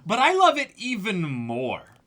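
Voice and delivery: usual speaking voice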